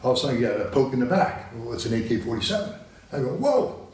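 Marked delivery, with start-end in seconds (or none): 3.3-3.9 a much higher startled whoa. Retold or imitated. Poked in the back by a soldier's rifle, startled high whoa. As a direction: jump at the rifle poke.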